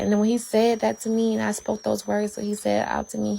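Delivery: ordinary talk, nothing marked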